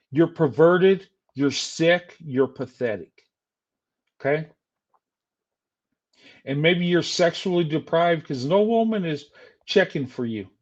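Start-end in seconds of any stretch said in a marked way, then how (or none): none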